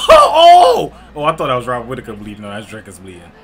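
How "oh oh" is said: high, loud oh breaking out of a laugh; laughing shout. Real, not acted. Reacting to blood on screen with a loud laughing shout. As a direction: yell in disbelief. Fighter bleeding, wild moment.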